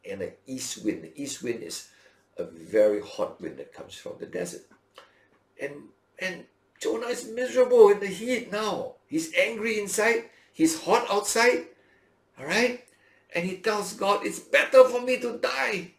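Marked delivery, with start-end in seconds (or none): 5.6-15.9 high, loud anguished complaint; miserable Jonah. Acted. Preacher voicing Jonah miserable in the heat, high and loud. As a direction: cry out as the suffering prophet.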